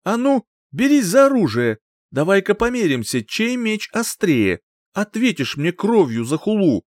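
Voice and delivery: high, loud furious challenge to fight; angry challenge. A narrator's reading. Young boaster challenges old singer to a duel, high angry voice. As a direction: dare him to draw his sword. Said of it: "перекосившись от злости".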